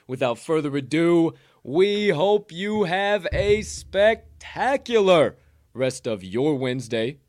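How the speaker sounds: big hype farewell to listeners; announcer outro. Acted. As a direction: draw out spectacular like a boxing announcer. Podcast sign-off announcer voice.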